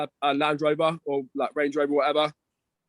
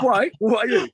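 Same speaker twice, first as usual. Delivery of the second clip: normal talking voice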